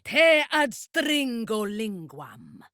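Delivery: slow Latin incantation; spell incantation. Acted. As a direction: intone the spell slowly. Casting a spell.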